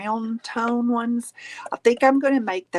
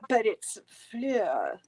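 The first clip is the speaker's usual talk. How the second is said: slow French "fleur" with a soft, rounded vowel, no hard English r; French accent; 5 dB quieter, slower than usual. Shown as a demonstration. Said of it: "like you say it with this weird little thing".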